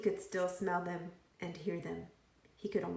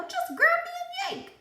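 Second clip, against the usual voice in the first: high, squeaky talking pigtail; 6 dB louder than usual. A narrator's reading. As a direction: play the cheeky pigtail, way up high.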